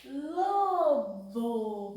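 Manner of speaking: Lobo said loudly, first syllable clear; word called out. Shown as a demonstration. Teacher naming the wolf word for children, loud. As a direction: announce Lobo to the kids.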